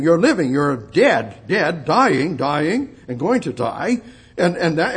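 ordinary delivery

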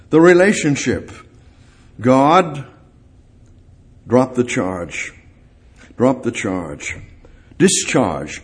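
ordinary talk, nothing marked

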